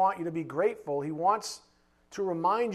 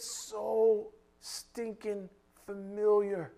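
slow solemn; 5 dB quieter, much slower than usual. Acted. Preacher slows, quiet emphasis.

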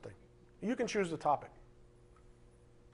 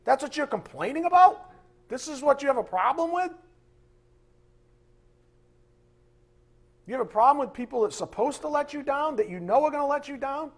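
loud, higher, stretched disbelief; incredulous outburst; 8 semitones higher, 6 dB louder than usual. Acted. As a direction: throw it at them in disbelief. Preacher rebukes complaining Christians.

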